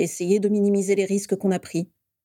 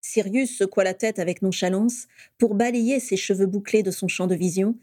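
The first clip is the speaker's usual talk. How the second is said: usual speaking voice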